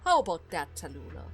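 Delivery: low, restrained needling; cool taunting. Acted. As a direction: taunt with quiet, measured control. Duke coolly taunts his daughter.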